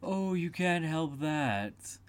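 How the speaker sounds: unhurried talking-cat voice; Cheshire Cat. A narrator's reading. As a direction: draw it out teasingly like the Cat. Cheshire Cat answers Alice.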